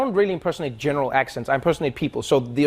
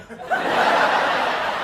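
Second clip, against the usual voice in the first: breathy laugh. Real, not acted. Laughs at own story.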